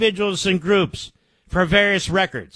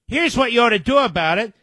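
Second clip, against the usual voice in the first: ordinary talk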